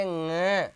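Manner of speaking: ङ said through the nose, slowly; nasal nga. Shown as a demonstration. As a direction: hum ङ from the throat into the nose. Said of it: "इसको बोलेंगे". (नाक से ङ का उच्चारण)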